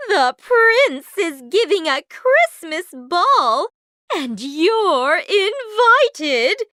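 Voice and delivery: loud, high gushing stepmother; exultant, theatrical proclamation; excited cry. A narrator's reading. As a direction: announce thrilling news with grand relish. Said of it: "she cried".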